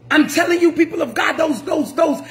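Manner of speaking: usual speaking voice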